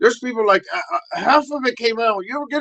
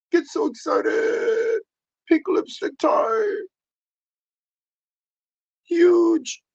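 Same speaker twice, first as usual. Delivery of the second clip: much higher, slow squeaky voice; excited squeal; 10 semitones higher, much slower than usual. Acted. Rico bragging his pink comes out, squealing high.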